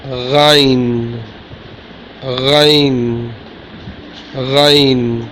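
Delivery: Ghain with a rasped throat r. Shown as a demonstration. Teacher contrasting letters, Ghain said with a throat rasp. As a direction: scrape Ghain at the back of the throat. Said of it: "First one, Ain, and it's Ghain".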